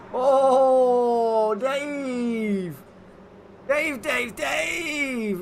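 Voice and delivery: high, loud excited yelling of a name; excited shout. Real, not acted. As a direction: cry out the name in thrilled disbelief. Cheering a driver's move.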